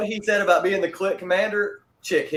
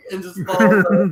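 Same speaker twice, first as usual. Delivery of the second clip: louder, faster narration cracking up; laughing through it; 4 semitones higher, 8 dB louder, faster than usual. Real, not acted. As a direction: keep laughing as you finish the story. Continuing the mock death.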